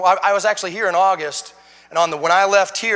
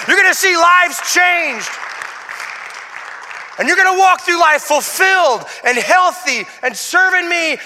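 fiery preacher; preaching at full force; 9 semitones higher, 5 dB louder than usual. Acted. Preaching climax.

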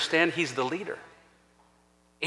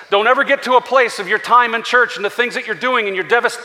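ordinary talk, nothing marked

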